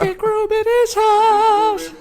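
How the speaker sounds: squeaky high-pitched mock echo; high silly voice; high falsetto.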